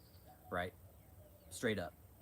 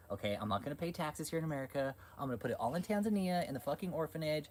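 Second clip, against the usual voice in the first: higher-pitched mock scheming; mocking impersonation; 6 semitones higher than usual. Streamer voicing a preacher's tax-dodging plan, mockingly.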